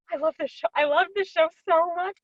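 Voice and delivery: gasp, then high gushing; through-the-roof excited. Retold or imitated. Actor reliving being cast in a beloved show, gushing excitement. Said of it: "absolutely just through the roof excited".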